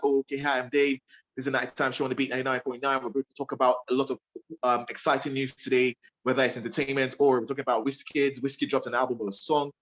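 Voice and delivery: rattling off a night-show intro fast; breezy DJ patter, rushed; fast radio talk. Shown as a demonstration. Radio host showing how fast he talks on air, rapid patter. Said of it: "I'm speaking really fast".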